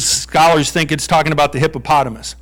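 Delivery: ordinary talk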